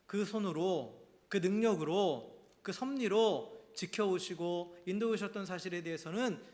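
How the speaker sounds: slow emphatic listing. Real, not acted. Preaching emphasis slowed.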